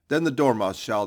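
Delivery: loud eager call; crying out. A narrator's reading. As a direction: call it out together. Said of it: "they both cried".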